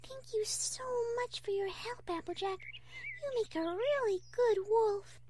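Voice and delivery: high, soft, grateful voice; Fluttershy. Acted. Fluttershy thanking Applejack for helping, soft and grateful. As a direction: thank a friend sweetly, high and gentle.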